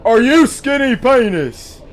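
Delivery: put-on high, loud, slow British accent; a posh British clerk asking. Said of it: "everybody has a British accent now".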